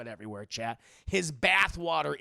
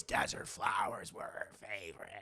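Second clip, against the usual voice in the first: softer, breathy whisper; whispered memory; 9 semitones lower, 7 dB quieter than usual. Retold or imitated. Son remembers his mother, hushed whisper.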